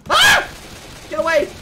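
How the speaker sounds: high shriek, then a loud yell; panicked scream. Real, not acted. Player attacked by zombie, panicked scream. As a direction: scream and fend them off.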